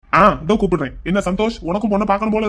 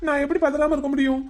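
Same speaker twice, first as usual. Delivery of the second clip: high, nervous justifying; flustered panic; 6 semitones higher than usual. Acted. Young man fears brother returning to find girl, flustered. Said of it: "பின்ன பதறாம".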